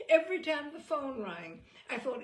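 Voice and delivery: plain reading voice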